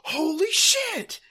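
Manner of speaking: hammed-up nervous wreck; [name] panicking over the shipment, high and panicky; mock freaking out. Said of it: "I'm freaking out".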